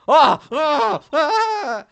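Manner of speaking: three high, loud shrieking yelps; shocked shrieks. Acted. Game character caught off guard, screaming three times. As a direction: shriek out in startled panic.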